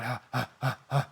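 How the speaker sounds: soft breathy pants, quiet; exhausted panting. Acted. Hydreigon resting after saving Edgar, soft exhausted panting. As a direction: gasp for air, spent.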